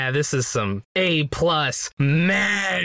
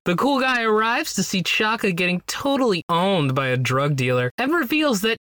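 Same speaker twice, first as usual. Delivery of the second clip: ordinary talk, nothing marked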